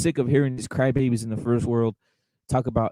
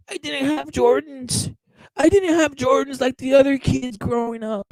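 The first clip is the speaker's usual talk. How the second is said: much higher, slow whiny mock voice; mocking whiny crybaby; 11 semitones higher than usual. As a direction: drag it out like a sulking kid. Mocking whiny American boxers.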